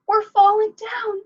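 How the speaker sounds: very high, loud, stretched-out cry of falling; panicked shouting. Acted. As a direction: wail it as you tumble. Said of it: "came a cry from above".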